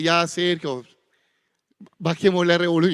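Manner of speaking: wife's request. Retold or imitated. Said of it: "Cindy a veces me dice".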